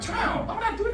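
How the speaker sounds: high-pitched scorpion objection; comic, high incredulous voice; mock protest. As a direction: pitch it way up in disbelief.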